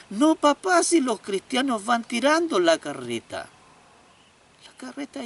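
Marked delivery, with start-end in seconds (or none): 0.1-3.5 put-on high, loud little boy voice; insistent child. Boy explains to his father, high and insistent. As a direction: hold your ground like a small boy.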